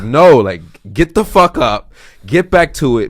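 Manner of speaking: loud, punchy order to himself to get up; self-directed yell. Retold or imitated. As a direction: bark it at yourself like a drill sergeant.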